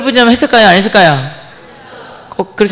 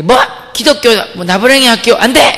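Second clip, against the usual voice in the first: raised mock-outraged refusal; higher, emphatic imitation of an indignant father; 4 semitones higher, 3 dB louder than usual. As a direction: act out dad forbidding it loudly.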